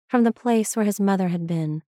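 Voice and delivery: plain reading voice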